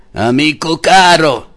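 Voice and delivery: high, loud menacing roar; a tyrant roaring at the friar. A narrator's reading. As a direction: roar it as a threat. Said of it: "ruggì il messere".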